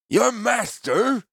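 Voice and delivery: slow, very high disbelieving question; incredulous merchant. Acted. The wine seller questions Gerard in slow disbelief. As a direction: stretch it out in disbelief. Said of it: "the merchant asked".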